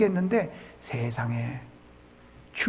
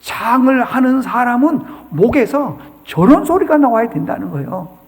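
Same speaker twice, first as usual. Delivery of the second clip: ordinary talk, nothing marked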